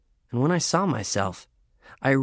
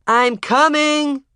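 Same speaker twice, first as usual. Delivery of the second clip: drawn-out call; 8 dB louder, much slower than usual. Acted. Yelling back.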